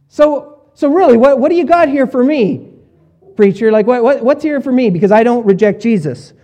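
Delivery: high, loud, dismissive listener's challenge; smug skeptic voice. Pretend. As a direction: play the doubter who thinks he's safe. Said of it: "you maybe are thinking the same thing".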